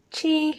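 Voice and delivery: Chi held high and level; flat first tone. Shown as a demonstration. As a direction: say Chi on one high, steady note. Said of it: "first tone flat".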